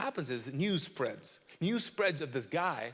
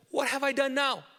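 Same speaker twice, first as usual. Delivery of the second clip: high, louder aggrieved comeback; younger sibling protest; 9 semitones higher, 5 dB louder than usual. Acted. David answering his older brother, high and indignant. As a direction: play the wrongly blamed little brother. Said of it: "younger sibling response".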